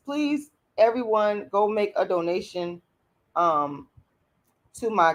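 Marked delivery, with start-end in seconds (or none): none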